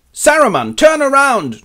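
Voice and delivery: high, loud command; challenge. Acted. Aragorn confronts supposed Saruman, high shouted order.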